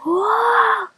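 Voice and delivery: high ghostly "whoa"; drawn-out high imitation of a strange sound; imitated eerie noise. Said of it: "it sounded like a".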